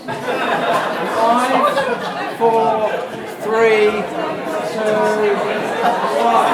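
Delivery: big loud count down to one; loud countdown. Real, not acted. Speaker counting the audience through the exercise, loud. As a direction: call the count to the room.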